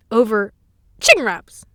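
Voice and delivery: loud, emphatic CHICKEN WRAPS; punched-out incredulous words. Acted. Repeating the absurd cause.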